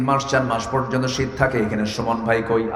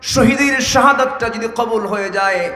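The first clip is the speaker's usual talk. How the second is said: high, loud declamatory chant; full-force preaching; 10 semitones higher, 5 dB louder than usual. Real, not acted. Preacher voicing Abdullah's wish for martyrdom, impassioned preaching.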